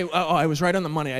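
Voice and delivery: normal talking voice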